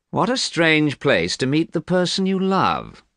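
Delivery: slow, high-pitched disdain; unkind. A narrator's reading. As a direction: draw it out mockingly, pitch well up. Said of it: "he said unkindly".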